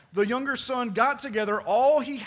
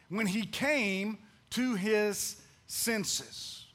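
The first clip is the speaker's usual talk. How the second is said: very slow, measured scripture reading, slightly softer; slow emphatic reading; 5 dB quieter, much slower than usual. Real, not acted. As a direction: draw out each word of the verse. Preacher reads key verse for emphasis.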